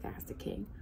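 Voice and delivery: hushed whisper. A narrator's reading. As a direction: breathe the words softly. Whispered narration tag.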